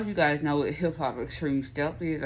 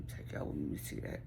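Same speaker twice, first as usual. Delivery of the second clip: whispering; 12 semitones lower, 12 dB quieter than usual. Real, not acted. Trailing off in a whisper.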